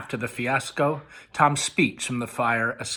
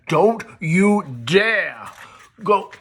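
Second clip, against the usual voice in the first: slow, higher, louder near-scream; screeching; 5 semitones higher, 6 dB louder, much slower than usual. A narrator's reading. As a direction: yell the warning at her. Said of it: "Fairly screeching".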